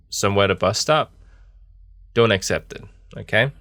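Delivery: ordinary talk, nothing marked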